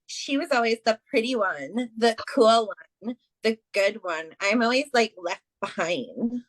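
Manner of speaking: lightly acted jealous woman, swooping pitch; singsong grievance, slowing and dropping at the end; put-on whiny complaint. Medium voices a jealous mother to her daughter, put-on complaining voice. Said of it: "I don't know why I put that voice on there".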